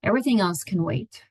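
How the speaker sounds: unhurried meditation guide, long pauses between phrases; guided meditation. Acted. Therapist guiding listeners into their own space, meditative and unhurried. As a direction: ease listeners into stillness, leaving space after each phrase.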